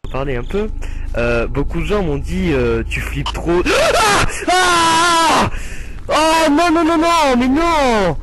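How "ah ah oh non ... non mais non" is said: high screams into drawn-out wailing no's; high-pitched jump-scare panic; scared screaming. Real, not acted. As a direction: scream in fright, then wail the refusal. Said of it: "Il m'a fait peur".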